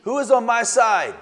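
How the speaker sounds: high, loud call to the window; Jehu calls out for anyone on his side; rallying shout. Retold or imitated. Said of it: "I believe that he says".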